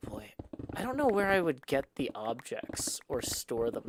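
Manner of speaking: ordinary delivery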